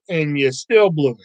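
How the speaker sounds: normal talking voice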